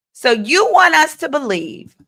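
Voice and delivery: ordinary talk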